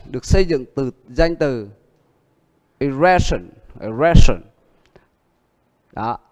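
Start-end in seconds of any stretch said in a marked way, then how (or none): 2.8-4.4 erection with the stress on the second syllable; stressed syllable demo. Shown as a demonstration. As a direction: punch the middle of erection, then fall. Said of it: "âm nhấn nó không thay đổi".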